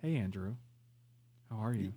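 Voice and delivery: soft, very low, creepy greeting. Acted. Host phoning his friend too intimately, low creepy voice. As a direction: creep on him in a low murmur. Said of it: "you gotta do this low, creepy voice".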